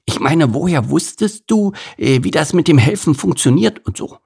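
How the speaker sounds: storybook beaver amazed; impressed beaver. A narrator's reading. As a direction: Bert marvelling at the bee's reward. Bert asking Isabel.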